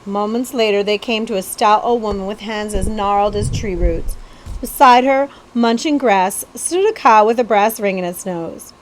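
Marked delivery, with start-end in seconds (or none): none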